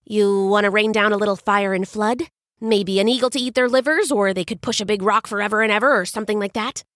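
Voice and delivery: fast jumbled chatter; words running together. Acted. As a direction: spill the ideas out in a rush. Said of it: "even his words ran together in a jumble".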